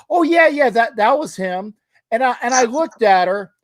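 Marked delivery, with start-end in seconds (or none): 0.1-1.7 high, casual mom voice; lightly voiced mom, high and offhand; imitating mom. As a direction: play the mom as if it's nothing. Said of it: "she goes".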